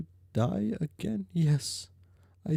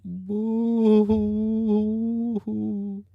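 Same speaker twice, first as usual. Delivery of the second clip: mock ghost sobbing; 5 semitones higher, 5 dB louder, much slower than usual. Jade wails at the memory.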